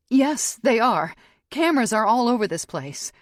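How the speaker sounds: high, loud insistence; calling his bluff. Acted. Captive calling her attacker's bluff, insistent. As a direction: insist forcefully to back him off. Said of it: "I call his bluff".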